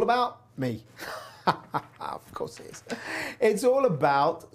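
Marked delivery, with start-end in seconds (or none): 2.0-2.8 breathy whispered self-mocking aside. Real, not acted. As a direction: drop to a whisper, wry aside to yourself. Self-mocking aside after laugh.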